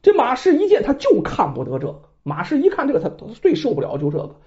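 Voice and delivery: ordinary delivery, nothing marked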